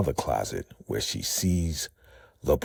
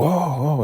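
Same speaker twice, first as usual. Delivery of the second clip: pained cry; 11 semitones higher, 4 dB louder than usual. Retold or imitated. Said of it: "hears somebody go".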